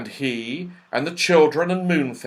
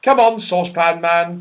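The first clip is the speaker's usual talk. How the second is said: raised, loud summons; calling; 5 semitones higher, 8 dB louder than usual. A narrator's reading. Joe calls Saucepan Man away from cats, loudly. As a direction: call over to someone distracted. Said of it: "called Joe".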